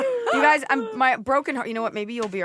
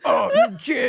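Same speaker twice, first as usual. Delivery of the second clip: high, loud mock bro voice with a laugh; hammed-up party bro shout; 6 dB louder, slower than usual. Mocking a DJ spraying champagne.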